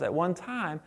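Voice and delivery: usual speaking voice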